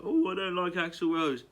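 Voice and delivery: mimicking a snooty complainer; mocking imitation. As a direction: put on a silly voice to mock the doubters. Mock-quoting people who pretend to dislike.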